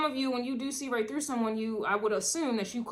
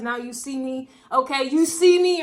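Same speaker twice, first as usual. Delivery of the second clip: rising hype wrestler shout, much louder by the end; John Cena; 3 semitones higher, 10 dB louder than usual. Retold or imitated. Quotes the wrestler's catchphrase to the viewer, growing louder. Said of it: "John Cena vibes".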